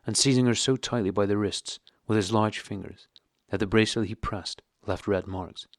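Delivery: ordinary talk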